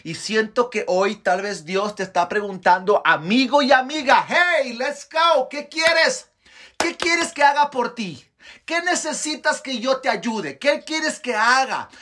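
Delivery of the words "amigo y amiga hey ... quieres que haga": high, urgent appeal to listeners; ringing preacher's challenge; impassioned preaching. Real, not acted. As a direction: press each question on the audience, pitched high.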